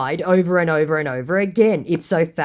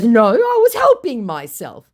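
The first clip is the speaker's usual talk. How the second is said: mock scammer voice; 8 semitones higher, 7 dB louder than usual. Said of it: "He goes".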